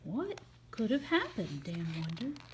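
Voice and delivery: plain reading voice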